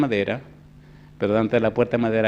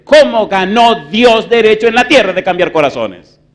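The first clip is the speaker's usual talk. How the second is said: loud, higher preaching voice building to a peak; fiery preaching; 9 semitones higher, 12 dB louder than usual. Acted. Preacher thundering his question to the church, loud and high. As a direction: preach it hard to the congregation.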